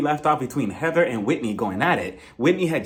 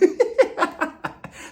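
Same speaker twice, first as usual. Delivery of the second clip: high airy laugh; breathy laugh; 13 semitones higher than usual. Real, not acted. Laughs at own joke.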